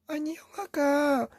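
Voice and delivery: put-on high, slow kid's voice; high little-kid voice tattling to dad; little brother voice. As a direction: whine it like a small child telling on his brother. Younger Teletubby complains to dad.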